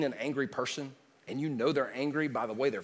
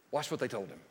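ordinary talk, nothing marked

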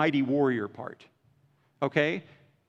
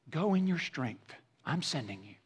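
quiet commission; 7 dB quieter than usual. Acted. Voicing the Lord's commission.

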